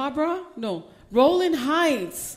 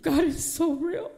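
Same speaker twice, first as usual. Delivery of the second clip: usual speaking voice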